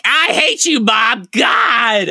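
put-on high whiny teen girl voice; angsty teen girl. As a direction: whine it out like a sulky teenager. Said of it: "That's my Angela voice".

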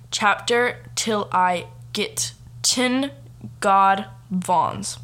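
slow, over-pronounced reading with pauses; halting, deliberate mock-careful reading; slow, word by word. Shown as a demonstration. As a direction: spell it out laboriously, word by word. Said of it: "really slowly and pronounce every single word".

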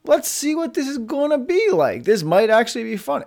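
intrigued self-talk; warming up to the challenge in your head; curious inner voice. Retold or imitated. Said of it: "my brain's thinking".

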